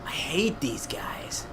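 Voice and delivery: slow whispered grumble; defeated, voiceless complaint; exasperated whisper. Real, not acted. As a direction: whisper it drawn out, to yourself. Exasperated after game mishap.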